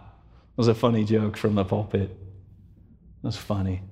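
low, fading murmur; imitated polite amusement. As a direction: play the congregation softly dismissing a joke. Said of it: "And they went".

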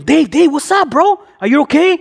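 excited buddy checking on a friend; eager concern. Retold or imitated.

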